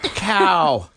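stretched slow pained exclamation; drawn-out groan. Real, not acted. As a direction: drag it out in pain. Suffering after spicy sushi.

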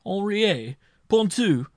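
high, loud, startled questions; alarmed cry. A narrator's reading. Marshal hears his servants will be questioned, alarmed cry. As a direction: cry out each name in sudden alarm. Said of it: "cried the marshal, trembling".

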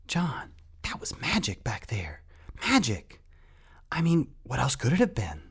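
high, breathless stage whisper; excited whisper. A narrator's reading. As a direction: whisper urgently, voice jumping high on the key word. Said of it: "he turned to John and whispered".

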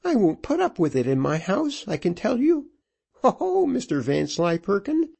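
high-pitched indignant widow; building rage. A narrator's reading. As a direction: scold him in a high voice, getting worked up. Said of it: "working herself into a rage".

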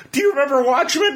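bursting to share the news; late-night excited call to a roommate. Retold or imitated. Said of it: "And I'm like".

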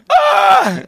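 loud, high-pitched wail; exaggerated drawn-out "aah"; mock wail. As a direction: cry out in hammed-up anguish, high and loud. Acts out his reaction to the show.

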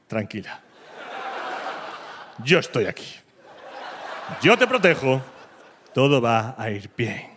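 put-on low, slow, soothing boyfriend voice; calm her down, slow and low; mock reassuring. Boyfriend soothing scared girlfriend after noise, slow and low.

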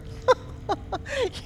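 high, scornful laugh; villain's mocking laugh. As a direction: laugh down at the fool.

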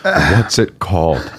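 slow, measured, composed question; deliberately calm and drawn out; really calm. Retold or imitated. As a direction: ask it slowly, keeping composed. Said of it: "Really calmly".

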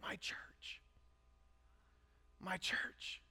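hushed, breath-only whisper. Retold or imitated. God speaking into the pastor's ear, whispered. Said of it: "God whispered in my ear".

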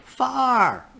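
very high, stretched puppet voice; Grover. Retold or imitated. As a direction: sing out the words like a furry puppet teaching kids. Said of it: "You remember Grover from Sesame Street?".

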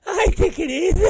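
very high falsetto with a giggle; Brandon. Retold or imitated. Coworker defending his joke, giggly mocking falsetto. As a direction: mimic him insisting, squeaky and tittering.

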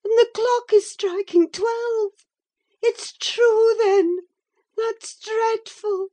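very high, tearful wail; horrified. Acted. Catherine horrified at the haunting.

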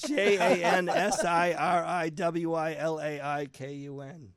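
letter by letter, slowly; spelling slowly. Shown as a demonstration. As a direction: spell it out one letter at a time. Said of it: "spelling the name".

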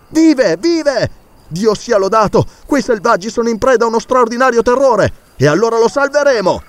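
joyful shout. Acted. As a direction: shout it out as rescue becomes possible. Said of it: "gridò Ferguson". (Ferguson grida di gioia ai compagni, acceso e alto)

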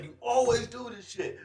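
high-pitched pretend complaint; mock snapping. Acting out Becky snapping at Charlotte, mock outrage. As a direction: protest high, acting fed up. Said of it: "I want Becky to fucking snap".

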